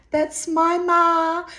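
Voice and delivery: very high, slow Thai-accented mimicry; exaggerated Thai-accented announcement, pitched right up; imitated Thai accent. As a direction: draw it out loudly in a mock Thai accent. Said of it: "in her Thai accent".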